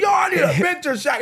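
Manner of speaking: high, loud excited demand; starstruck pleading. Retold or imitated. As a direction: yell it like an overeager fan. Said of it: "So I'm like".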